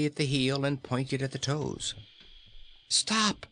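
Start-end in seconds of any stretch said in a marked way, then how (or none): 2.9-3.4 sudden high cry; excited cry. A narrator's reading. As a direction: call out sharply in alarm. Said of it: "he cried in an excited voice".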